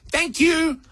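very high, polite mock child voice; polite little girl. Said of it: "very politely and loudly says".

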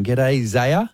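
ordinary delivery

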